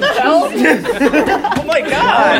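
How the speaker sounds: high loud laughing shrieks; laughing disgust. Real, not acted. Friends react to wet pants.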